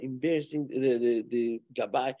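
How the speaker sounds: usual speaking voice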